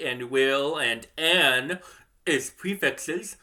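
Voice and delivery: slow, each word set apart; slow emphasis. Shown as a demonstration. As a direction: lay out "and will and an as prefixes" word by word. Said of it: "started thinking of I".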